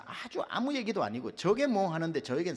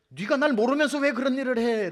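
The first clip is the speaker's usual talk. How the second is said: high loud retort hurled back at Satan; shouted rebuke against Satan's accusation; defiant shout; 11 semitones higher, 9 dB louder than usual. Acted.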